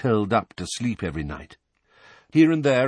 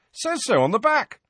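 high squeaky joke; 3 dB louder than usual. Acted.

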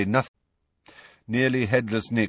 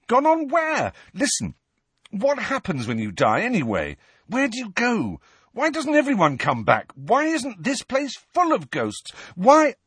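desperate demanding; 13 semitones higher than usual. Acted. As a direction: pile on the questions desperately. Harry desperately demanding answers.

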